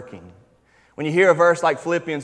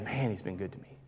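softer, hushed thankful murmur; quiet gratitude; 6 semitones lower, 13 dB quieter than usual. Retold or imitated. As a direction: say it softly, as if to yourself. Voiced grateful thought.

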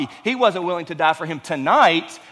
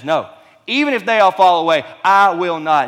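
louder, defiant declaration; bold loud vow; 2 semitones higher, 8 dB louder than usual. Retold or imitated. Preacher voicing Peter's defiant vow to Jesus, loud. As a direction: throw the vow out with force. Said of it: "our Peter, the leader of the apostles".

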